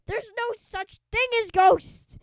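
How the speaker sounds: a bully refusing to believe; shouted denial; angry yell. Acted. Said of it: "Kipper yelled".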